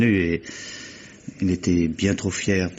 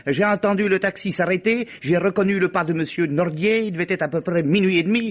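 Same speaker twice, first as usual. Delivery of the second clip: much higher, prim parody of the concierge reciting her lesson; mocking concierge voice; 10 semitones higher than usual. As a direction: ape the concierge parroting a rehearsed alibi. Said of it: "Et puis la concierge, là". (imitation moqueuse de la concierge, voix bien plus aiguë)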